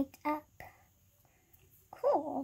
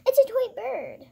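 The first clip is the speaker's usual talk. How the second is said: gasping in delighted surprise; high, loud, amazed discovery; surprised gasp; 8 semitones higher, 5 dB louder than usual. Pretend. Gasps at finding toy bird.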